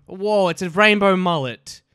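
loud, slow, delighted whoa; genuinely thrilled by the hat; excited. Real, not acted. Sees new hat.

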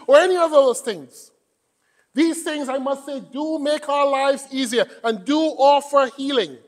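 preaching. Real, not acted. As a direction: proclaim it forcefully to the congregation. Preaches at full force.

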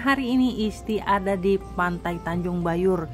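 ordinary delivery

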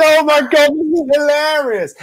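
very high, loud mock delight; hammed-up amazed spectator; hysterical audience. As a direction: squeal it slowly like a crowd in fits. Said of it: "Everyone's laughing so hard".

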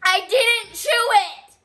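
high, loud protest in pain; pained shout. Real, not acted. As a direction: cry out through the heat. Burning from hot sauce.

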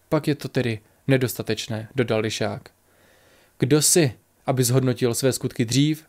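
usual speaking voice

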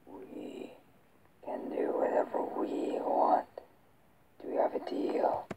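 whispered monster voice; Venom. Shown as a demonstration. Man echoing the creature's offer of a deal, whispered impression. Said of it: "I got his voice down".

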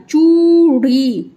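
चूड़ी with long "oo" and long "ee"; stretched vowels. Shown as a demonstration. Teacher demonstrating the long matras in चूड़ी, slowly stretched. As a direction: pull out both vowels of चूड़ी. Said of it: "देखिए खींच करके".

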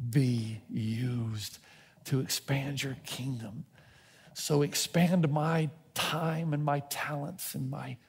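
slow, soft, earnest prayer; a hushed sincere prayer to God; quiet prayer. Acted. Voicing heartfelt prayer.

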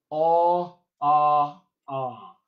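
AH, AH, AH separately: first rounded 'aw', then two open 'ah's; vowels in isolation. Shown as a demonstration. Coach isolating the vowels of tall coffee latte, separate held sounds. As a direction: sustain each AH alone, the first rounded, the last dropping. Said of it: "I only have two vowels here".

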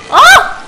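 loud high yelp of disgust. Real, not acted. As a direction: yelp at something gross. Disgusted cry. (grito agudo de asco)

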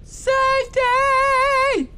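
very high, slow, loud stretched call; hammed-up slow yell for a lost dog; long drawn-out call. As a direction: holler it across the yard for the dog. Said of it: "yelling out your door".

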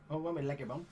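normal talking voice

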